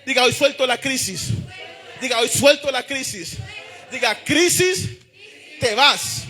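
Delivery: high-pitched, driving sermon delivery; full-force preaching. Real, not acted. Preacher urging the congregation to wake up, impassioned full-force preaching. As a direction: preach it at the top of your register, relentless and rousing.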